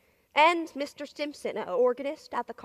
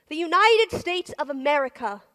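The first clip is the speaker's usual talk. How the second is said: louder and higher, fall at the end; loud answering take; proud ringing declaration; 4 semitones higher, 8 dB louder than usual. Acted. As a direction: proclaim the name with pride. The name the soldiers knew.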